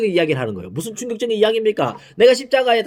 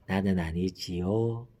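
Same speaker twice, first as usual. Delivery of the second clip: much softer, slow and lower, 나는 아니지요 asked furtively; sly quiet question; 13 semitones lower, 11 dB quieter, much slower than usual. Retold or imitated. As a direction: ask it quietly like a guilty man. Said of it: "가룟 유다도". (가룟 유다처럼 낮고 조용히 묻는 목소리)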